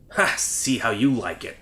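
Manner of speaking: normal talking voice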